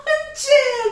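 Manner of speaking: no manches between laughs, high-pitched; riendo incrédula. Real, not acted. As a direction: laugh it out in disbelief. Risa incrédula. (riendo agudo, sin poder creerlo)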